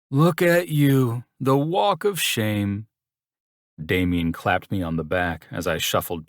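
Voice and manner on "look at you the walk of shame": teasing. A narrator's reading. Teasing friend.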